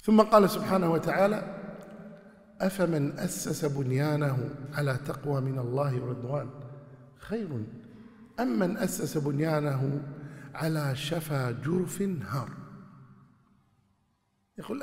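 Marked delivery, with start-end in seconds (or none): 2.6-12.5 slow chanted verse, trailing softer; Quran recitation. Acted. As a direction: recite the verse with measured tajweed.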